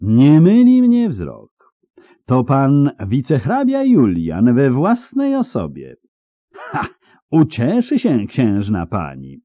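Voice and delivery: slow, high knightly proclamation; lingering, high pompous declaration; drawn-out. A narrator's reading. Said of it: "powiedział przeciągle".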